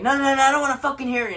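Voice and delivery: fast, high, loud imitated refusal; angry cut-off. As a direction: shut the caller down mid-sentence. Angry cut-off before hanging up.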